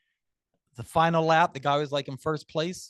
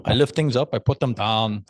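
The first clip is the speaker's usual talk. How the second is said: loud, blunt meathead voice; Planet Fitness gym guy; 2 semitones lower, 8 dB louder than usual. Retold or imitated. A club speaker quoting his favorite Planet Fitness ad, loud mock meathead. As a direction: belt it out like the gym-bro ad. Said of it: "Planet Fitness when they do".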